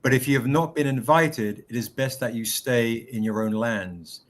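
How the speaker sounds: low, measured passage reading; reading aloud. Acted. Reads a passage from the book.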